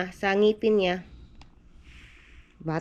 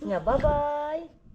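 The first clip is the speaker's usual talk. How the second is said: sing-song bye-bye; 4 semitones higher, much slower than usual. Real, not acted. Mother tells her toddler son to wave goodbye, slow sing-song.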